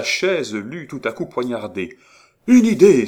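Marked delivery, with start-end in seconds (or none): 2.5-3.1 much higher, loud burst of discovery; sudden cry. Acted. Étienne leaping up and crying out his idea. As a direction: shout it as it strikes you. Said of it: "s'écria-t-il".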